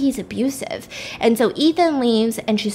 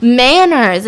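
loud, drawn-out announcement; loud mock-impressed declaration; emphatic exclamation; 7 dB louder than usual. Word found, said with emphasis.

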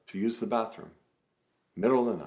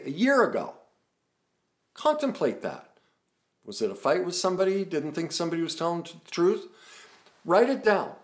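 urgent coaching; 9 semitones higher, 6 dB louder than usual. Acted. Coaching listeners through memory exercise.

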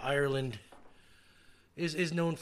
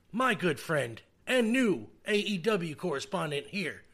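usual speaking voice

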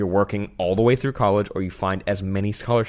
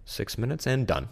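quiet; 4 semitones lower, 8 dB quieter than usual. Retold or imitated. Self-talk under deadline.

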